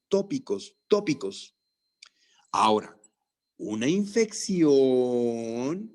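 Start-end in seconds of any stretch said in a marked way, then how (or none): 3.6-5.9 una infección, very slow and deliberate; drawn-out teacher emphasis; said slowly. Shown as a demonstration. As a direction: spell it out slowly for the class. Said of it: "y te lo digo despacito".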